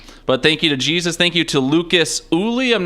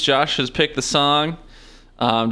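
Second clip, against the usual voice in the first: usual speaking voice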